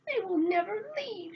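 high exasperated cry; shouted despair. A narrator's reading. Old woman overrun by fairies, high shout of despair. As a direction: cry out as if overwhelmed. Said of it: "shouted the old woman".

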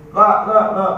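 loud, high no no no; imam's loud refusal. Retold or imitated. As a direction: wave the man off loudly. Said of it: "imam cakap".

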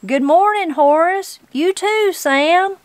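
high, lilting call across a room. A narrator's reading. Granny greets two judges from the audience, calling out. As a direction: hail them from afar, pitched well up. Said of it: "She called".

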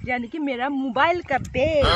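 ordinary talk, nothing marked

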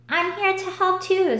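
a chirpy helpful father; lightly voiced Cricket's dad.

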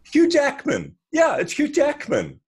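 imitating his wife's starstruck gush; thrilled wife. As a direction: swoon over the name like a starstruck fan. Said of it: "her eyes are popping out of her head".

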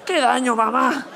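high-pitched put-on kid complaint; comic child wailing for treats; mock hurt child. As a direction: cry out to mum, hammed-up. Acting a kid hurt, demanding donuts.

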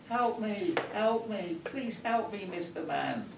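squeaky high little frog begging for help; pleading frog. Acted. Frog in the street pleading with the passing man, high squeaky voice. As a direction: beg like a tiny frog in the street. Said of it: "And the frog was saying".

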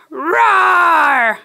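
mock roar for the red dino; hammed-up toy roar; playful dinosaur roar. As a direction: pretend roar shouted for a toy.

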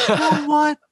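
defiant kiss-off. Retold or imitated. Telling off manager in story.

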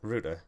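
router said as "rooter"; router, first syllable rhyming with boot; British router. Shown as a demonstration. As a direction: say router with an oo, like "root". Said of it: "You mean router".

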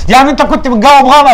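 loud accusation. Acted. Repeated exam scene.

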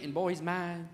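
ordinary talk, nothing marked

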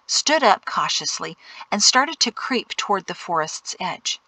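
ordinary delivery, nothing marked

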